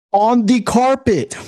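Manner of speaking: On the carpet said high and slow; high, slow, disbelieving. Real, not acted. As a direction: stress "carpet" in a high, stretched voice.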